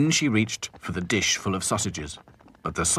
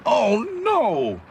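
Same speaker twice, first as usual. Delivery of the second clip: high, loud dismayed cry; 5 dB louder than usual. A narrator's reading. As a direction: exclaim in dismay. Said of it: "he cried".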